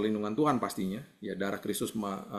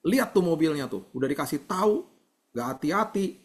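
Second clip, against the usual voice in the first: high, louder mock scolding; scolding parent; 6 semitones higher, 4 dB louder than usual. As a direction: act out a dad telling off his child. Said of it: "saya tidak mau begini". (pura-pura memarahi anak dengan nada tinggi)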